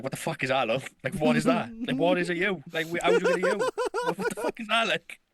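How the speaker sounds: imitating an exasperated dad over a bad grade; dad building from annoyed to incredulous; pissed-off parent. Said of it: "so pissed off".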